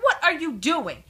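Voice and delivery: high, loud exasperated demand; exasperated mother's outburst; retold exasperation. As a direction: demand it of a child, incredulous and loud.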